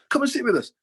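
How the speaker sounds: high, loud insistent call. Retold or imitated. Senior pro insists the apprentice joins them, high loud call.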